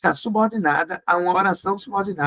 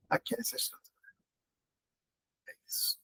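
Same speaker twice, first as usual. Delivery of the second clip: whispered aside; 16 dB quieter, much faster than usual. Real, not acted. Quiet aside to students.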